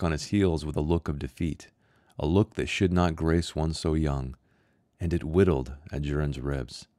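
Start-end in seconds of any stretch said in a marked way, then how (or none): none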